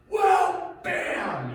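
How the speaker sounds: high, loud sudden shout; mock shout. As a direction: explode with the blow. Acting out hitting the wife.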